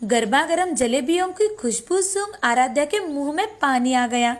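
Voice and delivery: usual speaking voice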